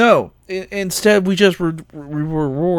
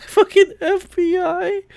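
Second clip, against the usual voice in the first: laughing through the words, pitched up; amused laugh. Real, not acted. Recounting the crypto rug pull, amused and laughing. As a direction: break up laughing mid-sentence.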